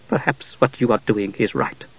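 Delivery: ordinary delivery, nothing marked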